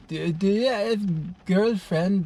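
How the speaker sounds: high, slow Eastern European neighbor; Eastern European accent. Retold or imitated. Elderly neighbor asking about his love life, Eastern European accent. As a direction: imitate an old Eastern European lady's halting English. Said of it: "with her beautiful Eastern European accent".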